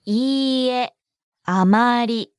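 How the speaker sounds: いいえ, a pause, then あまり, both stretched; slow model. Shown as a demonstration. Teacher breaks down the negative response, slowly.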